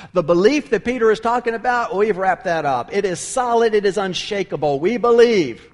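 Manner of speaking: loud fervent preaching, building to a shout; preaching at full force. Acted. Preacher stressing Peter's firm belief, loud and fervent. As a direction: drive the point home to the congregation.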